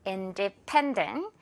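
Independent with pen hit hard, drawn out slowly; slow, lean on pen; stress on pen. Shown as a demonstration. Teacher drilling the stress on pen, slow. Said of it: "펜 부분에 강세 있어요".

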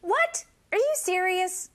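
high, incredulous questioning; astonished disbelief. Shown as a demonstration. Teacher models reacting to sales news, astonished disbelief. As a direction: react to news you can barely believe.

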